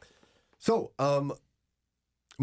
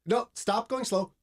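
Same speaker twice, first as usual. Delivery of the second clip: impatient shopper; 9 semitones higher than usual. Retold or imitated. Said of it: "It's like".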